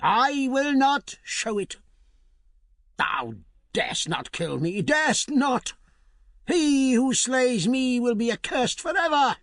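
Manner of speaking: put-on a shrill-pitched curse from an old hag; defiant witch. A narrator's reading. Witch defies the spear, high curse.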